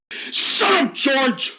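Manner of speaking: high, tearful shout; telling his friend to stop, tearful; crying outburst. Acted. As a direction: snap through tears. Finn tearfully snaps at George.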